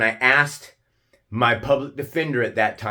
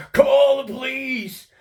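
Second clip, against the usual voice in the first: much higher, slower mock shriek of "Call the police!"; retold scream; 14 semitones higher, 4 dB louder, slower than usual. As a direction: ham up his panicked yell. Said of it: "That's how he responded".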